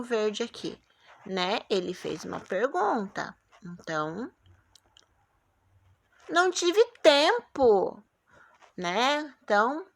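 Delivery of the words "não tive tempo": slow, higher, louder reading of the line; teacher demo of a statement; reading with intonation. Shown as a demonstration. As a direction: read it out slowly with feeling. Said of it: "que ponto será que eu coloco aqui no final".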